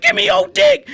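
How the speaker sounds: very high, loud hammed plea; manic demand. To the retreating bully: very high, loud mock-manic demand. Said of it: "I'm like".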